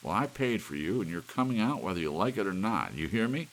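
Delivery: loud, high threatening yell; husband shouting at the clock; furious shout. A narrator's reading. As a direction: bark it like an ultimatum.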